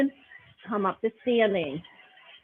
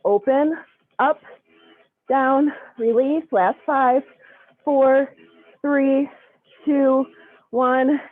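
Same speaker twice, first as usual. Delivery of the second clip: aerobics instructor; 5 dB louder than usual. Acted. Loud cueing through glute bridges.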